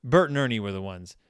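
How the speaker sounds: normal talking voice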